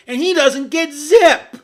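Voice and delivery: loud, high indignant outburst; outraged exclaim. Real, not acted. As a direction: protest it at full volume. Indignant defense of Colorado.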